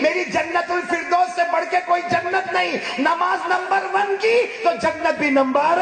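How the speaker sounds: high, loud sermon with chanted verse; preaching at full force. Acted. Preacher reciting Quran verse to congregation, chanting. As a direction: lift the verse slowly in recitation.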